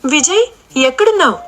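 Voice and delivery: searching call into the crowd. A narrator's reading. Jyothi calls out for Vijay.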